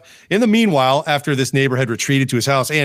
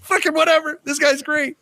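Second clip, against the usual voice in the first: laughing; 13 semitones higher than usual. Retold or imitated. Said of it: "I'm like".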